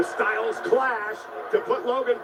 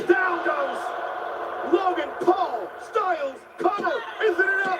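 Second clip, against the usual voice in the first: excited shout; 7 semitones higher than usual. Real, not acted. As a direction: yell the near fall out. Calls a near fall live.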